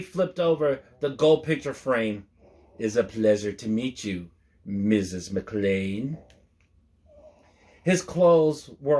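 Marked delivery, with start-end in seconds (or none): 2.8-6.2 sly villain greeting. A narrator's reading. Hans greets Holly menacingly.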